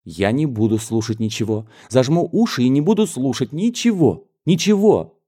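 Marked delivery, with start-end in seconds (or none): 1.9-5.2 put-on higher, rising into a childish louder cry; stubborn girl refusing to listen, voice climbing; childish outburst. A young cousin refusing to hear gossip, childish defiance. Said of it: "при этой детской выходке".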